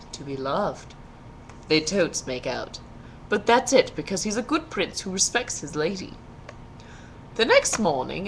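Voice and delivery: plain reading voice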